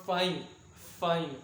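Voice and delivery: "Fine" said with effort, as the strong syllable; "fine" repeated on its own, each time weighted; stressed syllable. Shown as a demonstration. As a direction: put the stress firmly on "fine". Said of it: "fine दोस्तों sound निकालते वक्त मेरा stress लग रहा है".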